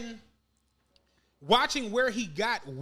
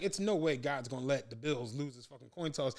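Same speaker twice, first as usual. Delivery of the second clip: quiet dread; 10 semitones lower, 6 dB quieter than usual. Retold or imitated. Dreading the coin toss.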